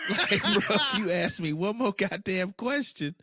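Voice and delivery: loud, high comic rant; mock outrage. Friend fed up with texted questions, loud mock outrage. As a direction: blow up in mock anger, laughter close by. Acts exasperated reply to texts.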